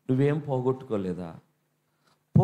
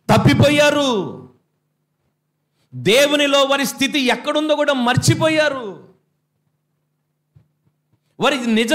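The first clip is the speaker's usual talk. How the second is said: raised, louder preaching at full force; 10 semitones higher, 7 dB louder than usual. Acted. Pastor urging the church to seek what was lost, preaching loudly. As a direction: drive the exhortation home to the congregation.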